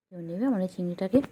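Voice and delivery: loud playful call to the audience. Real, not acted. Owner calling to viewers about her pet, loud and playful. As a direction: raise the voice and offer the pet up.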